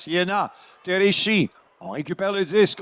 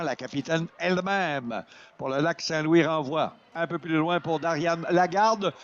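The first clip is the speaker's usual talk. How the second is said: ordinary talk, nothing marked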